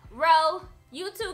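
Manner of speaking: ordinary talk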